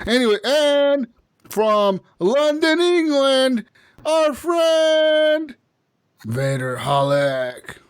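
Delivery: slow, stretched presenter voice with high lifts; announcer guest intro. Acted. Host introducing the guest from London, slow announcer voice.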